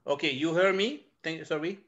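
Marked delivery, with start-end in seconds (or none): none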